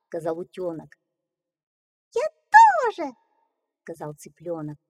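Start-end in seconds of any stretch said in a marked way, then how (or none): 2.1-3.1 tiny squeaky chick, much higher. Acted. Chick echoes.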